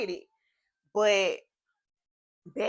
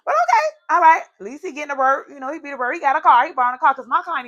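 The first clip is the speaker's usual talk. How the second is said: loud mock cheer; 6 semitones higher, 12 dB louder, faster than usual. Sarcastic mock reassurance.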